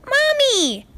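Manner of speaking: put-on very high, gleeful child's Mommy; little filly squeal. A narrator's reading. Filly greeting Spitfire, very high gleeful squeal. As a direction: squeal it up high like a delighted foal. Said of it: "the filly said".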